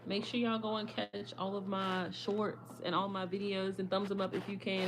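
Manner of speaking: ordinary delivery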